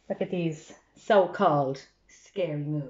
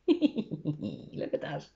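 laughing; 4 semitones lower, 5 dB quieter, much slower than usual. Real, not acted. Storyteller laughs at the scene.

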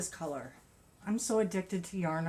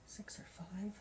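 whispered aside; 13 dB quieter than usual. Real, not acted. Unsure aside about yarn weight.

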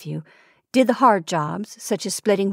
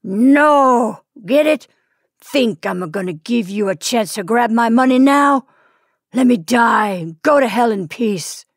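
louder angry roar; 3 semitones higher, 5 dB louder than usual. Acted. Dying husband refusing his wife and the priest, roaring. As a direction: roar your refusal at her. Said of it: "the Titan roared aloud".

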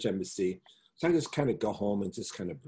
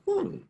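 thoughtful high hum. Retold or imitated. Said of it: "made you kinda go".

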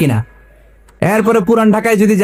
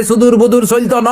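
higher, rhythmic answering chant; mock procession slogan; 4 semitones higher than usual. Preacher plays the second group of marchers, mock slogan chanting. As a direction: imitate marchers chanting in Feni dialect. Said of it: "আরেক দল কয়".

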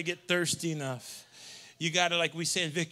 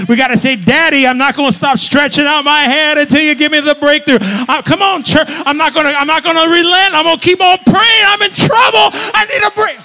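higher and louder, building to a cry; climactic preaching shout; 8 semitones higher, 17 dB louder than usual. Acted. Preacher crying out to God for breakthrough, building shout.

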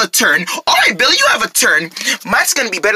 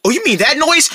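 normal talking voice

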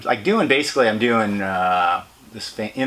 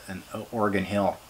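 ordinary talk, nothing marked

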